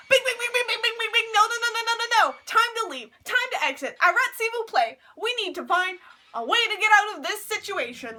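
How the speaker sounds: high, loud alarm babble; mock panic at a high pitch; panicked inner siren. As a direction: blurt it like a siren going off. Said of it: "sirens are going off in my head".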